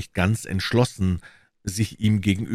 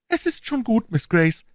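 agitated. A narrator's reading. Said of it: "entgegnete Mrs. Jones erregt".

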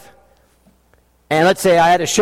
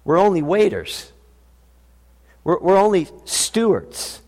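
ordinary delivery

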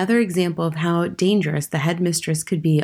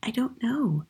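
hushed, higher whisper back; Matilda's whisper; 7 semitones higher, 8 dB quieter than usual. A narrator's reading. Girl answers her friend in assembly, whispering back. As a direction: answer very softly, secretively. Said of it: "Matilda whispered back".

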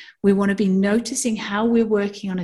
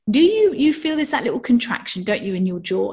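ordinary delivery

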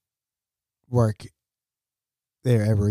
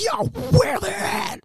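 high, drawn-out roaring shout; Hulk-like yell; much slower than usual. Retold or imitated. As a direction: bellow it slowly like a raging monster. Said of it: "I'm turning into, like, the Hulk".

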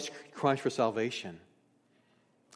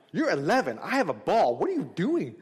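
exasperated yell; 6 semitones higher, 8 dB louder than usual. Retold or imitated. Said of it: "I yell".

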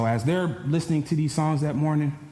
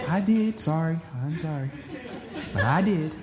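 embarrassed laugh; 5 semitones higher than usual. Real, not acted. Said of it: "I cried like a baby".

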